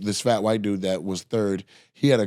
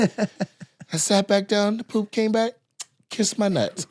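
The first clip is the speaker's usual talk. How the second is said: high, drawn-out comic voice; imitated punchline delivery; 9 semitones higher, slower than usual. Comic finishing the poop joke, high imitated voice. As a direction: pitch it high and space out the punchline.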